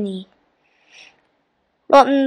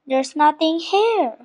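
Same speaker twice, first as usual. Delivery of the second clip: soft, higher-pitched complaint; moaning; 5 semitones higher, 7 dB quieter than usual. A narrator's reading. Kipper finds the island empty, moaning. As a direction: moan it mildly, voice lifting at the end. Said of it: "moaned Kipper".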